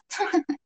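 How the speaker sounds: short high chuckle; light, quiet acted laugh; brief amused titter. A narrator's reading. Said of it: "She laughs".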